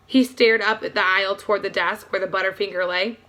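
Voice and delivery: ordinary delivery, nothing marked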